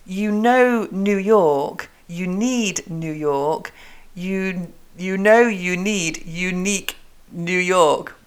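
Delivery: slow, careful "you know New York"; tongue-twister "unique New York", each word measured; articulation drill. Shown as a demonstration. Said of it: "here's a nice one, with".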